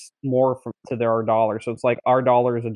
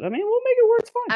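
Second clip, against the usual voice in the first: high squeaky voice; faster than usual. Acted. Joking about sleeping outside.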